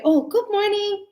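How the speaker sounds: high, cheery "Oh, good morning" to a regular; friendly barista. Retold or imitated. Barista greets a regular customer, cheery high voice. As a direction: welcome a familiar face warmly. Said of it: "then they".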